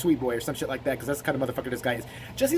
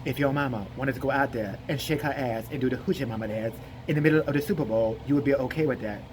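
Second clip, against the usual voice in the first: low, drowsy interviewer voice; lazily slurred mock-serious question; Jesse Lee Peterson; 4 semitones lower, 3 dB quieter than usual. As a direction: pose it in a sleepy drone. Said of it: "He's like".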